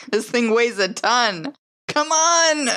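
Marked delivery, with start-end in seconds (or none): 1.9-2.7 high, strained urging; urging the van. Acted. Driver willing the heavy van round, urgent plea. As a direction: coax it as if willing it to move.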